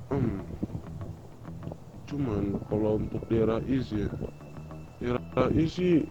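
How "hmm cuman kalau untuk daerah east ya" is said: normal talking voice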